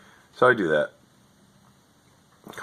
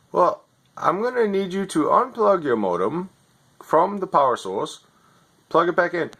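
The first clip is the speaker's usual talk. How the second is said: higher, scripted helpdesk patter; overseas tech support voice; 8 semitones higher than usual. Retold or imitated. Overseas agent reading his script to a customer, lightly mocking. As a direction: read the script like a bored call-centre agent. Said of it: "You're not even in America".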